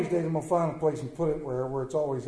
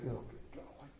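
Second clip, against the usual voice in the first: unvoiced, soft, under the breath; muttered groan; 15 dB quieter than usual. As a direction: grumble to yourself. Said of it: "Fred Craig's like".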